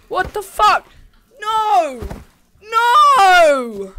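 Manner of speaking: high, loud frightened no; panicked shouts. Real, not acted. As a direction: yell in sudden fear. Attacked again in game.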